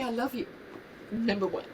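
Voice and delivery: softly tearful through real tears; soft, real tearful love; crying. Real, not acted. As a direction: say it while tears come. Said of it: "I don't wanna burst into tears".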